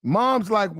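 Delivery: ordinary talk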